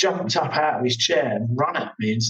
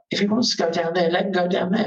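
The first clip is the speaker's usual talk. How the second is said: mock mate; 6 semitones higher than usual. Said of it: "his mate was like".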